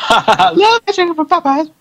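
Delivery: high voice breaking into laughter; laughing silly voice. Acted. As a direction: giggle it in a squeaky voice.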